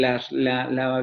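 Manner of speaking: ordinary delivery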